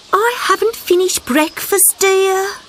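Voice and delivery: lightly high, unhurried brush-off; a lazy mum at breakfast; mother frog voice. A narrator's reading.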